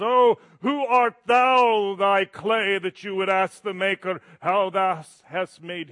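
loud, slow, archaic scolding; stern scripture voice. Retold or imitated. Pastor mocks moralists quoting Job, in a loud archaic rebuke. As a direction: boom it like an old pulpit rebuke. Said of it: "where it says".

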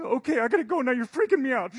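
high, loud squeaky bird in a rush; hurried hummingbird. Acted. Hummingbird rushing off after his message, high hurried squeak. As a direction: squeak it out, eager to dart off.

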